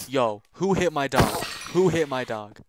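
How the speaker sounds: loud angry demand. Real, not acted. Gamer loudly demanding who hit his dog. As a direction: confront whoever hit the dog.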